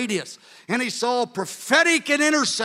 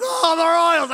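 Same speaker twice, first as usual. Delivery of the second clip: higher, louder mock groan over the team; lamenting fan; 8 semitones higher, 6 dB louder, slower than usual. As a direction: mope about the Royals. Brother lamenting his team.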